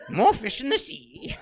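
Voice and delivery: high, slow, loud troll; hammed-up troll voice. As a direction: drag it out as a comic troll. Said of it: "that's a troll voice".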